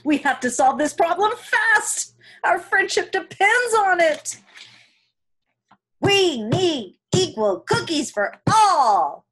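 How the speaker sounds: earnest rallying cry for equal cookies; urgent plea. Acted. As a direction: insist loudly that it must be fair.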